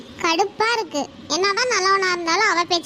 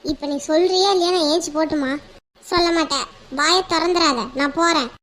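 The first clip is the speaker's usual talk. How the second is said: ordinary talk, nothing marked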